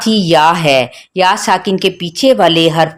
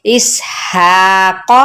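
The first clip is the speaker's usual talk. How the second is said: इस हा को with हा held long, को short; stretched हा; slower than usual. Shown as a demonstration. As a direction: draw out हा, keep को short. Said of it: "हा को खींचेंगे". (हा को खींचें, को को छोटा रखें)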